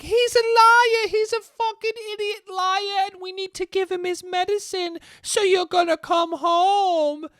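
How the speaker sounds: shrill cartoon villain aunts nagging; mocking the aunts. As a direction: aunts scolding James in a high mocking voice. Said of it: "his aunts are like".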